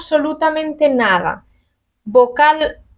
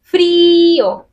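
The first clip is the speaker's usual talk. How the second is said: Frío más alto y fuerte, golpe en la I; stress on the I; 6 semitones higher, 3 dB louder than usual. Shown as a demonstration. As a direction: punch the I in Frío. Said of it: "la vocal I recibe la fuerza". (Frío con fuerza en la I)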